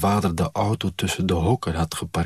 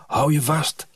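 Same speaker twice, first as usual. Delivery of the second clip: higher-pitched brisk command; urgent warning; 7 semitones higher than usual. A narrator's reading. Father at the wheel warns the child, urgent. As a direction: warn her as you set off.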